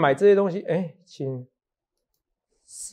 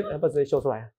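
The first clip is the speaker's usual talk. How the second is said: usual speaking voice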